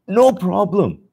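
no problem, up high and proud; emphatic. Real, not acted. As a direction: lift it with pride. Car drove home without trouble.

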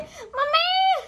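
high yelled imitation of a call for mum; mock yell for mummy. Imitating how her sister yells for mum, a high mock call. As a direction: pitch it up high like a child calling mum. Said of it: "ये क्या चिल्लाती है".

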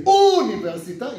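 università with a long, loud, high opening U; stress on first U. Shown as a demonstration. Teacher shows students how Czechs say università, stress on first U. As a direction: stretch and punch the U that starts università. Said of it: "you are Czech".

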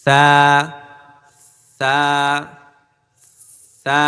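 th-sound "সা" with tongue tip between the teeth; lisped, breath-led "সা"; soft airy "সা". Shown as a demonstration. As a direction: let air hiss out first, then say "সা". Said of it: "এই বাতাস বেরোনোর সাথেই থা".

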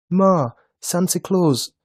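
put-on high, loud, urgent child; slow, high little-boy call; boy starting to tell. A narrator's reading. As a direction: begin blurting it out to mother. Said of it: "the carrot began".

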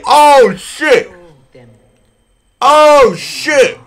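loud, high, excited exclamation; high hyped yell; amazed shout. Real, not acted. Excited reaction to trailer.

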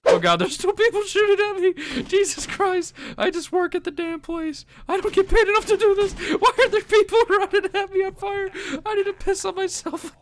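high, loud half-laughing panic; panicked high cries. Real, not acted. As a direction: shriek in laughing panic under fire. Under fire, panicked laughing.

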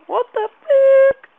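sudden high slow yell of shock; startled shriek. Real, not acted. Gamer shrieks at a sudden scare, startled. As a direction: shriek it out in fright.

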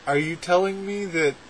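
ordinary talk, nothing marked

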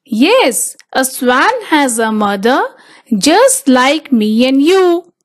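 plain reading voice

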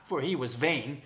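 ordinary delivery

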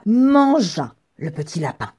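loud, punched storytelling word; dramatic climax. A narrator's reading. Narrator as the wolf eats the rabbit, loud dramatic punch. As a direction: hit it hard like a pounce.

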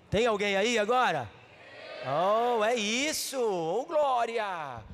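loud, rising preacher's cry; fervent preaching. Real, not acted. Preacher rousing a sleepy congregation, fervent and loud. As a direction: build from rebuke to a shout of glory.

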